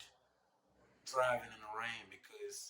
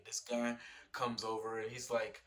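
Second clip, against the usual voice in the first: normal talking voice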